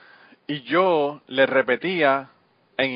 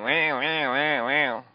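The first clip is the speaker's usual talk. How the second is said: wordless mock Kentucky twang; lightly caricatured Kentucky twang; twangy Kentucky accent; 2 semitones higher, much slower than usual. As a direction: imitate a Kentucky drawl in nonsense syllables. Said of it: "es un acento así bien twangy".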